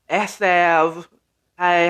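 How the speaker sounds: usual speaking voice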